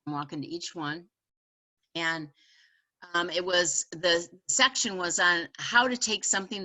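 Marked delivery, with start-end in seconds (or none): none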